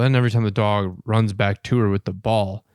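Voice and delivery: ordinary talk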